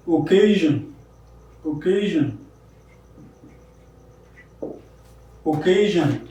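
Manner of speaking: occasion with the CA syllable stressed; occasion, middle syllable carrying the stress; stress on second syllable. Shown as a demonstration. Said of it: "K is stressed".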